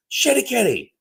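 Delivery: high mock yell of the cat's name; neighbor yelling. Mimicking the neighbor at his cat, high mock yell. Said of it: "Him just going".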